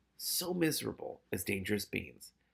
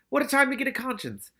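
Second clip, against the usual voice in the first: exasperated; 13 semitones higher, 6 dB louder than usual. Acted. Morris exasperated thought.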